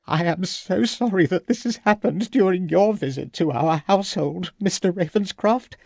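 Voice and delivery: a gentle distressed hostess. A narrator's reading. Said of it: "said his hostess".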